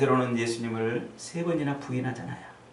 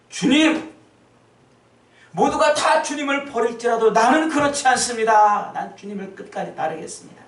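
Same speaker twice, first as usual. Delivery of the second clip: much higher, loud protesting cry; fervent Peter; 9 semitones higher, 6 dB louder than usual. Acted. Peter protesting to Jesus, high and loud. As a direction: swear loyalty with everything you have.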